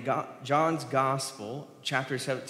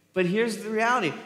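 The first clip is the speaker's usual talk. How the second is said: ordinary talk, nothing marked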